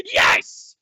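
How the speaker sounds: huge laugh bursting into a yelled cheer; triumphant shout. Real, not acted. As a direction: roar with gleeful victory laughter. Game master celebrates killing a character.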